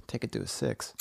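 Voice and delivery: hushed, breathy take on the phrase; calming whisper. Shown as a demonstration. Friend soothing an angry friend, whispered. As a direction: whisper it softly to calm him down. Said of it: "Calm it down".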